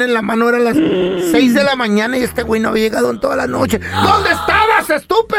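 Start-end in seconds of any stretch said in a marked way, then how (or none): 3.8-5.4 scolding screech from a sergeant of a wife; angry wife. Acted. Wife confronting husband home at dawn, angry shout. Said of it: "la sargento con un sartén".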